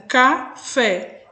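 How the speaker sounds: "café" broken slowly as ca, fé; split into two syllables. Shown as a demonstration. Teacher counts syllables for pupils, "café" split in two. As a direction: say "café" in two separate beats. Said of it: "o som duas vezes".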